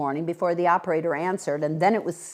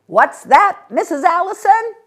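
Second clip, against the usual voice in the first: loud; 14 semitones higher, 13 dB louder than usual. A narrator's reading. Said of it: "Mister Babcock said loudly".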